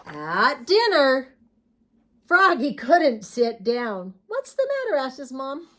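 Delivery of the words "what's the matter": high motherly voice; mom voice. Acted. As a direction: ask like a caring mom.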